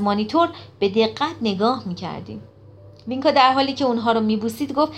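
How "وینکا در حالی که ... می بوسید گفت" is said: usual speaking voice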